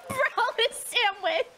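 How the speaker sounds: giggling through the words; laughing. Real, not acted. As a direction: say it while cracking up. Laughing while repeating joke.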